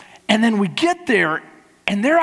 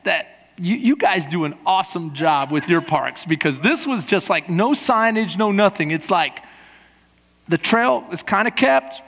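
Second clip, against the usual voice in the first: ordinary talk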